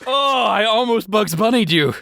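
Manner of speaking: very high, squealing glee; high, drawn-out delighted lament; gleeful near-miss. Real, not acted. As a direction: crow in a high voice at almost winning. Gleeful at nearly tricking friend.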